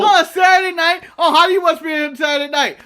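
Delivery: loud, high disbelief; incredulous shout. Acted. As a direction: yell it like it's absurd. Mocks the Saturday night idea.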